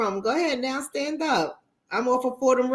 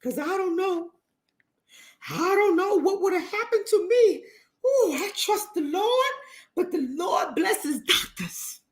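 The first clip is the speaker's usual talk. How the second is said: high, laughing and sobbing as a hammed-up grateful believer; mock pious relief; 8 semitones higher than usual. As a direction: ham up a tearful churchgoer praising doctors, voice up high. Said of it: "it's like".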